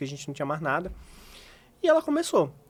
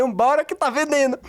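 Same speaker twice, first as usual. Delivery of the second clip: loud, high rallying cry; husband cheering his wife to keep selling, loud; urging; 10 semitones higher, 6 dB louder than usual. Retold or imitated. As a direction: pump her up to keep going.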